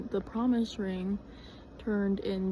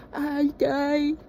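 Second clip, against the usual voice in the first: mock dying; 6 semitones higher, 6 dB louder than usual. Groggy flu victim after waking.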